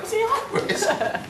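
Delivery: mocking high voice. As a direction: squeak it out, teasing, laughing. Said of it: "You're like".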